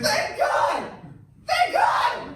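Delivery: high, loud cry of thanks; high-pitched theatrical relieved wail; hammed-up relief. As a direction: shriek it out in comic desperation. Humpty hears help approaching.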